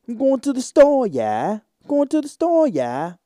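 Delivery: loud, high-pitched mock Cockney, dropping on each "yeah"; Cockney accent. Shown as a demonstration. Wannabe south Londoner trying out the accent, exaggerated Cockney. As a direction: put on a shouted, high Cockney voice. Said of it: "Try and get that Cockney accent".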